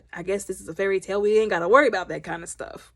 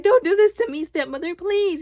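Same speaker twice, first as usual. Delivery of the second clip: higher pleading voice; pleading daughter; 9 semitones higher, 3 dB louder than usual. Retold or imitated. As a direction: beg like a mistreated girl.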